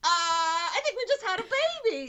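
high, laughing mock astonishment; stunned staff. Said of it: "we're all like".

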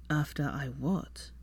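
weary puzzled question; Draco, confused. A narrator's reading.

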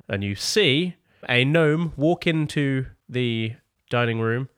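normal talking voice

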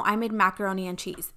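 ordinary talk

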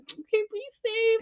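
very high mocking mother voice; mom's goodbye. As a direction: parrot mom's sign-off up high. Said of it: "She's like".

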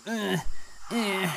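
high effortful ugh, eh; straining grunt. Acted. Man pulling a chest, high strained grunts. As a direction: grunt like hauling a heavy load. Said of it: "he goes".

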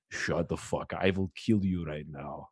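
low, quiet mock threat; menacing dad. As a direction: imitate a dad threatening in a dropped, hushed voice. Acts out his dad's threat.

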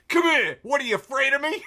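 mock old man shouting high and loud; old dad's challenge. Rogan's dad challenging Joe in a loud, high mock shout. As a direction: ham up a feisty old dad daring his son. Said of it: "he's like".

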